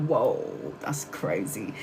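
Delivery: hushed, low, awed "whoa"; stunned whisper. Retold or imitated. Telling viewers her mind was blown, hushed and awed. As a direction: let it drop away in quiet disbelief.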